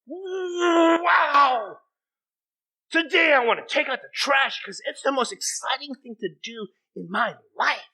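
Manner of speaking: gushing, over-the-top thrill, pitched high; hammed-up morning enthusiasm for a chore; mock excitement. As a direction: start on a long, high squealed wow, then gush. Mock eager waking up to chores.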